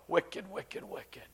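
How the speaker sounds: dramatic whisper, trailing away; very soft, appalled whisper; hushed condemnation. Acted. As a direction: whisper it like a dark aside. Aside on the sinister deed.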